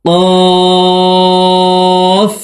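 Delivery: কফ chanted high with the back of the tongue on the palate; qaf from tongue root. Shown as a demonstration. Teacher demonstrates qaf for viewers. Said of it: "জিহ্বার গোড়া তার বরাবর উপরের তালুর সঙ্গে".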